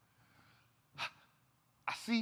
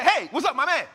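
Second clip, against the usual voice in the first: loud, raised retold greeting; hearty greeting; 5 semitones higher, 9 dB louder than usual. Peter hailing his man at the door, hearty greeting. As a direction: call out like greeting a buddy.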